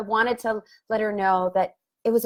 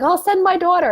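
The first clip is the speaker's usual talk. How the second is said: much higher, light friend's voice; imitating friend; 10 semitones higher, 4 dB louder than usual. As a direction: brightly offer help. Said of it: "she's like".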